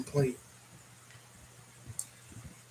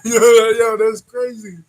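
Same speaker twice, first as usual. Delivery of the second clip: laughing disbelief; 4 semitones higher, 11 dB louder, slower than usual. Real, not acted. Laughing at surprise discovery.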